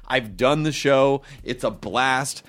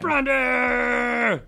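calling out; 10 semitones higher than usual. Pretend. Calling out a name.

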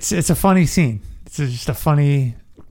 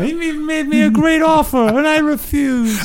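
terrified victim; 12 semitones higher than usual. Pretend. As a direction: cry out like a man who learned too late. Continues victim voice.

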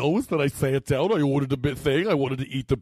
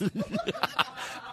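soft, very high laugh; 9 dB quieter than usual. Real, not acted. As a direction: break into a high, quiet laugh. Cracking up over sponsor joke.

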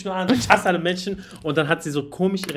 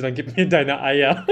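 said through laughter, slow and loud; can't keep a straight face, slowly; laughing; 3 semitones higher, 6 dB louder, slower than usual. Retold or imitated. Retold friend's odd demand. (lachend und laut, langsam gesprochen)

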